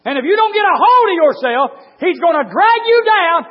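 high, loud, drawn-out pulpit shout; full-force preaching. Real, not acted. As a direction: shout the scripture slowly to the congregation.

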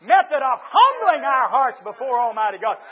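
forceful, drawn-out preaching. Real, not acted. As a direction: drive it home like a revival preacher.

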